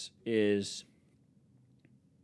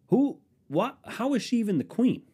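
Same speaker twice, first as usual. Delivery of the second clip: incredulous outburst; 13 semitones higher, 4 dB louder than usual. Real, not acted. Ranting disbelief at the Queen.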